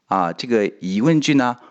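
normal talking voice

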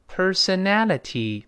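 personality 读得很慢，T 发清楚; slow reading. Shown as a demonstration. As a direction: read personality slowly, clear T. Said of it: "先慢慢读". (先慢慢读 personality)

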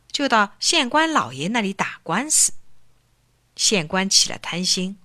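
plain reading voice

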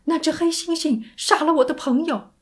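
loud, high, alarmed cry. Acted. Sandy reporting the chimp attack to operator, crying out. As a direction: call it out in urgent alarm. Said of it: "山迪叫".

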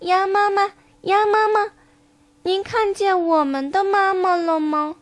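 put-on high, childlike tadpole voice; little tadpoles. Tadpoles asking mother duck for their mum, high little voices. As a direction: ask as tiny tadpoles, pitched high. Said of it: "它们一起游到鸭妈妈身边，问".

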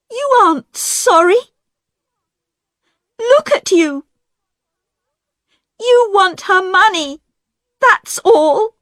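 high, loud tearful accusation; angry accusation. A narrator's reading. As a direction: lash out at him. Diane accuses Roger angrily.

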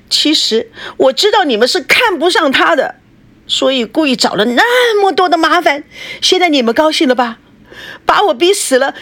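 high, raw, desperate tirade; a daughter screaming at her parents in hysteria; hysterical shouting. A narrator's reading. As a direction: throw every word at her parents. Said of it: "孙娜歇斯底里地喊着".

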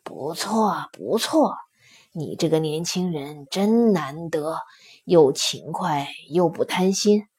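low, soft, approving elder-like voice; quiet, deep lion voice with a smile; smiling stone lion. A narrator's reading. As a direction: praise him gently, pitched well down. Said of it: "石狮子笑着说".

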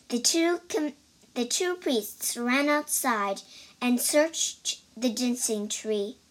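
ordinary delivery, nothing marked

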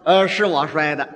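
just own up; plain confession. Shown as a demonstration. Child admits breaking the cup, plain confession. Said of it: "这小孩儿说".

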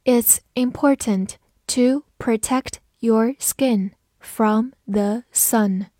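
a slow, spaced-out model reading. Shown as a demonstration. Teacher modelling the sentence for learners, slow reading. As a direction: read slowly, word by word. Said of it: "慢慢来读".

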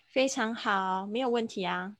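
normal talking voice

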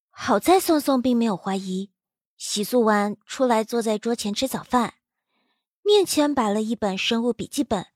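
ordinary delivery

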